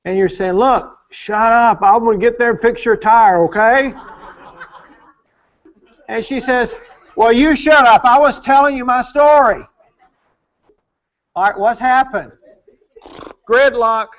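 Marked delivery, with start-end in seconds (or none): 0.5-3.9 impatient husband. Acted. Husband to his wife with a flat tire, impatient and dismissive. Husband cuts off wife's story. 7.2-9.6 loud, higher retort; indignant wife. Acted. Wife to her husband who won't listen, indignant loud retort.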